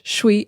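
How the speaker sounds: one-syllable "Shwe"; "Shwe" with only the sh left of "should"; reduced "should we". Shown as a demonstration. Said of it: "if we use that phrase".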